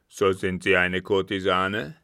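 loud captain, contemptuous questioning; disdainful captain. A narrator's reading. Captain takes the girl for a dancer, disdainful. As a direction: question her loudly with disdain.

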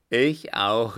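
loud eager cry; shouted agreement. A narrator's reading. Vagabonds joining in around the circle, shouting. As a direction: shout your agreement with the others. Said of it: "erscholl es im Kreise".

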